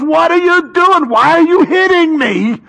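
comic high-pitched donkey complaint; hammed-up talking donkey. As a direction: play the wounded donkey pleading with its rider. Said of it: "the angel turns around and he goes".

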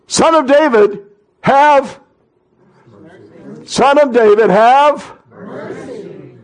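high, loud drawn-out call, dropping on mercy; crying out. Acted. Blind men calling out to Jesus for mercy, loud pleading cry. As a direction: holler the name, then plead for mercy. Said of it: "to call out and cry out to Jesus".

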